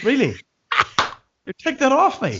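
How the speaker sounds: high, squealing disbelief; high playful protest. Pretend. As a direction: squeal it in mock outrage. Playful tussle over the wine.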